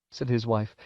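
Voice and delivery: ordinary delivery, nothing marked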